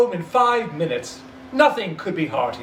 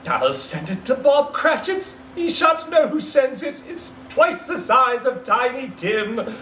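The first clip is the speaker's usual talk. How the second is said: gleeful; 7 semitones higher than usual. Acted. Scrooge gleeful, scheming.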